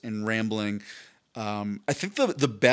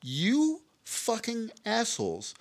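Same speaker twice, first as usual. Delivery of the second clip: disgusted; 9 semitones higher, 4 dB quieter, much slower than usual. Retold or imitated. Furious at contest result.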